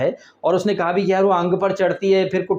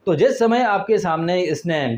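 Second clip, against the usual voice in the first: ordinary talk, nothing marked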